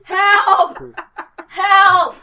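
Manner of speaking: loud laughing cry for help; laughing scream. Real, not acted. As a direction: shriek help while laughing. Trypophobia panic, laughing.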